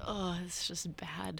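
slow, near-whispered aside; very soft, pained reaction; hushed cringe. Acted. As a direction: wince it out under the breath. Acted cringing aside at bad mimicry.

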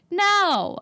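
high, exasperated string of noes; emphatic refusal. Real, not acted. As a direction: shut the idea down, pitched high. Firmly rejecting the scene's logic.

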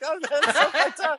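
high and slow, laughing through it; laughing disbelief. Retold or imitated. After a jump scare, laughing in delighted disbelief. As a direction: giggle out the shock.

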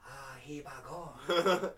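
slow, drawn-out mock Japanese accent; reverential Japanese accent. Host voicing Japanese reverence for the creature, slow mock Japanese accent. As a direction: say it like a bowing, awed Japanese villager. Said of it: "They bow when they say".

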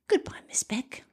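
soft whispered sign-off; hushed goodbye. Pretend. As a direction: whisper a playful farewell. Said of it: "I just have to whisper".

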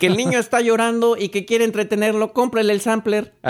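high vendor pitch; singsong market price calls. Pretend. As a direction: hawk it like a market seller. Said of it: "meterme a algún mercado".

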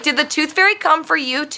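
put-on high little-girl question; little niece's voice. Niece asks the friend missing teeth, high child voice. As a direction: chirp it like an innocent kid. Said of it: "She was like".

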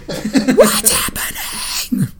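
laughing through a mock scared girl; mock confused little girl; confused Poltergeist girl. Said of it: "like the girl in Poltergeist".

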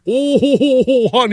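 very high Minnesota caricature, long drawn O; exaggerated Minnesota accent. Host imitating every person in Fargo, hammed-up Minnesota accent. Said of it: "every single person in the Fargo is just".

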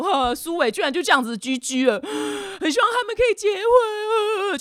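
hammed-up tearful moan; mock lamenting. Friends mourn Suwei's breakup, mock wailing. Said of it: "大家就在呜呼哀哉说".

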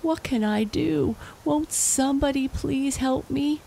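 high desperate plea; wailing. Acted. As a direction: beg for help, wailing. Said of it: "the farmer wailed".

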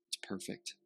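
breathy whisper on "it's perfect". Acted. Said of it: "repeated reassurance, whispered take".

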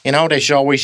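ordinary talk, nothing marked

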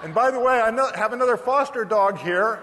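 calling home to his wife, loud and bright. Retold or imitated. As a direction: announce yourself coming through the door.

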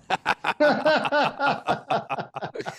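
big high burst of laughter; hearty laugh. Real, not acted. Laughs at own joke.

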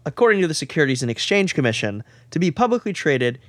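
ordinary delivery

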